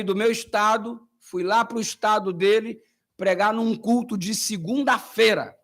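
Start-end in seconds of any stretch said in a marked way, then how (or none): none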